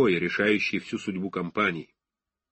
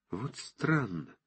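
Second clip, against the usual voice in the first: to himself; 8 dB quieter, slower than usual. Acted. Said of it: "говорил про себя".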